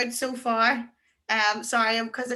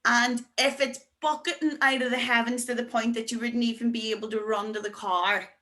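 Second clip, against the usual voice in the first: usual speaking voice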